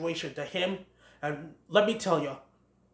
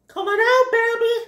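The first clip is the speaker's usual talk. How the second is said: high, loud searching holler; calling out; 9 dB louder than usual. Acted. Willow calls for missing Bambi, high holler. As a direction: call for someone hiding, pitched way up.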